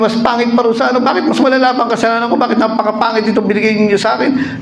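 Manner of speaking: complaining man. Acted. Second man complains about bride.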